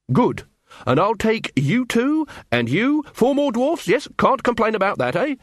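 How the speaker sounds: brisk recruiting officer. Acted. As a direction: sweep them up before they object. Carrot briskly recruits more dwarfs.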